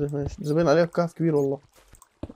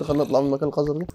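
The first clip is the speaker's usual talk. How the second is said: usual speaking voice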